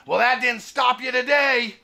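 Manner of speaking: high mock throat-buzzer comeback; imitated electrolarynx retort. Old man answering trespassing excuse, imitated buzzer-voice retort. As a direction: shoot back as the cussing old man. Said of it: "he looks right at me and says".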